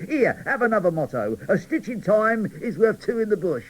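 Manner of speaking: jolly show-off telling mottos; cheerful cracker cheering up gloomy dolls. Acted.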